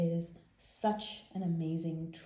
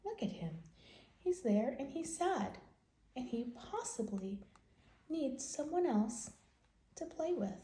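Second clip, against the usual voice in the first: lively picture-book read-aloud voice; reading to children; 4 semitones higher than usual. Acted. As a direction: tell the story to little kids.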